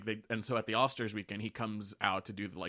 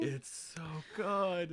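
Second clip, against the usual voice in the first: swooning gush; 7 semitones higher, much slower than usual. Real, not acted. Gushing about fic's love scene.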